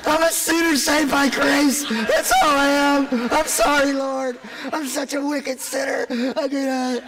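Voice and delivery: high, slow contrite pleading; humbled sinner voice. Pretend. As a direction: shrink down and confess. Humbled confession to the Lord.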